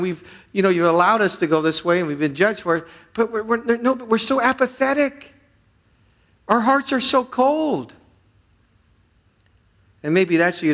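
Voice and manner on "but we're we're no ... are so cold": high, impassioned, slowing at the end; preaching cry. Real, not acted. Preacher pleading with an apathetic congregation, high and impassioned. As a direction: lean into it, almost crying out.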